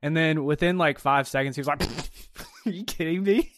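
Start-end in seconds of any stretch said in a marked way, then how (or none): 1.8-3.5 higher-pitched, chuckling mock disbelief; imitated chuckle. Podcaster imitating the coach's answer to a reporter, chuckling incredulously. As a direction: imitate someone laughing off a silly question. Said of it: "And he kinda chuckled".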